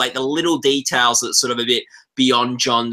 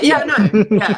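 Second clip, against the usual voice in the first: laughing mock voice; 8 semitones higher, 4 dB louder, slower than usual. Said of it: "He's like".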